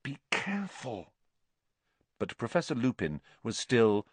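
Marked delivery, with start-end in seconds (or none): 0.0-1.1 breathy, hushed whisper; whispered warning. A narrator's reading. Hermione warning Ron beside the sleeping teacher, whispering. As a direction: whisper urgently so nobody wakes. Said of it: "hissed Hermione".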